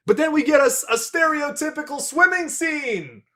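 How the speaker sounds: high, loud hype, away from the mic; mock-excited scream. Podcaster hyping a cliché swimming scene, mock-excited scream. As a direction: shout it out in over-the-top excitement. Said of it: "scream that because it was really exciting".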